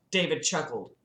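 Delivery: plain reading voice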